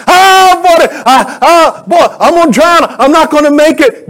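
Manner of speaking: high, loud mock cry of someone drowning; hammed-up panicked wail; acted panic. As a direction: cry out as if going under. Acts out a drowning, convinced believer.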